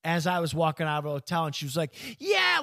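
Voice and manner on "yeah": acting crazy. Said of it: "just acting all crazy".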